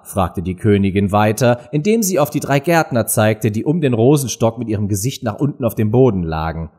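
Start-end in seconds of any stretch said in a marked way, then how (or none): none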